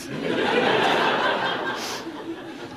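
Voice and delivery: breathy high laugh. Real, not acted. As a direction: laugh at your own joke. After the punchline.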